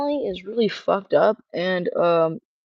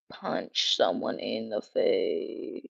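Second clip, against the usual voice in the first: low, slow frustrated mutter; 12 semitones lower, 7 dB quieter, much slower than usual. Real, not acted.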